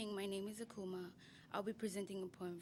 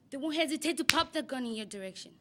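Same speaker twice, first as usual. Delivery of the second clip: louder, punching each word; forceful declaration; 4 semitones higher, 10 dB louder than usual. Acted. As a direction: deliver it like a warning. Poem climax.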